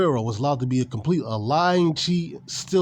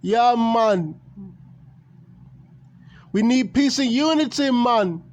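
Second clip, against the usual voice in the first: put-on Jamaican accent; 7 semitones higher, slower than usual. Mock Jamaican wrestler promo.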